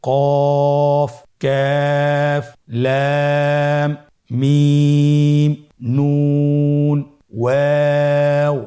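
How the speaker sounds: each letter name stretched very long and loud; drawn-out letter names. Shown as a demonstration. Teacher reviewing all letters with students, each drawn out. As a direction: hold every letter name for a few counts. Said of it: "আবার আরেকবার বলি".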